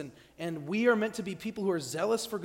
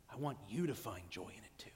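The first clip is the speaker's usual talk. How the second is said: soft whispered confession; 11 semitones lower, 11 dB quieter than usual. Real, not acted. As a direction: whisper it like a secret. Intimate confession in sermon.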